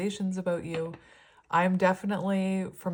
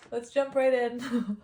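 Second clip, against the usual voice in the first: laughing; 7 semitones higher than usual. Real, not acted. Laughing after rambling aside.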